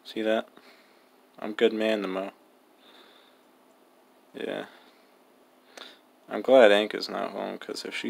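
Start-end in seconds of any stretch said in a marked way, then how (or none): none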